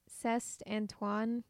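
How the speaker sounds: C'EST said slowly with a sounded final "st"; halting beginner French, C'EST ending in an audible T; mispronounced French. Real, not acted. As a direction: misread C'EST like "sest", uncertainly. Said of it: "that's not how you pronounce that".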